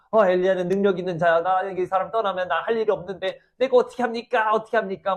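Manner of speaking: cling and plead, at a loss what to do; desperate pleading. Acted. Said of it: "막 그렇게 해요". (어쩔 줄 몰라 매달리는 목소리)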